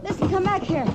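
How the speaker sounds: high, urgent cries after the leaving men; Kitty crying out to stop the mob leaving; desperate calling after. Acted. Shouting after departing crowd.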